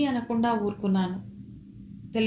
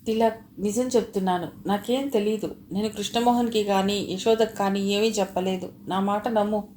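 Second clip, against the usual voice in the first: ordinary delivery, nothing marked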